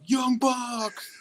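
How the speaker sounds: drawn-out, high imitation of a yell; retold yell. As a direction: imitate a fan calling out from across the bar. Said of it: "starting to yell out".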